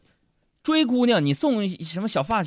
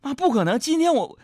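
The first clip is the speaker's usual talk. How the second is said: high-pitched mock whine; hammed-up wronged child; tearful protest; 10 semitones higher than usual. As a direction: plead innocence with pitch raised. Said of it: "当时我就气哭了".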